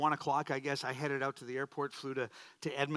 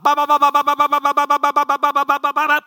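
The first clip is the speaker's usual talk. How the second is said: loud, high, rapid-fire mock babble; excited speaking in tongues; 11 semitones higher, 17 dB louder, faster than usual. Pastor mimics a church sister going off in worship, excited mock tongues. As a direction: imitate ecstatic tongues on one piercing repeated syllable. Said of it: "would get all excited in her spirit".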